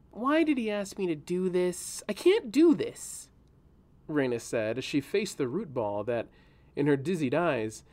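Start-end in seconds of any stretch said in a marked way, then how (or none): 0.1-3.3 higher, rising into a whine of despair; a girl who feels the task is too big; young girl voice. A narrator's reading. Reyna whining to herself about the task, voiced high.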